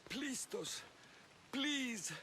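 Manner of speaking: high, desperate plea; frightened pleading. Acted. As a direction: beg for mercy in a high, thin-volumed voice. Priest pleads as he is threatened.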